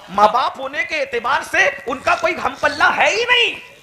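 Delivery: high, loud, laughing sermon cry; fiery preaching. Acted. Preacher rousing the gathering with soaring praise, loud. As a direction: rouse the crowd at full force.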